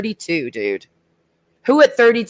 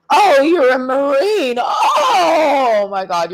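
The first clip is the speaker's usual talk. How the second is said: higher, louder fake-excited gush; mock gushing; 7 semitones higher, 3 dB louder, much slower than usual. Mocking women impressed by a Marine, loud fake gushing. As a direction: squeal like a starstruck fan.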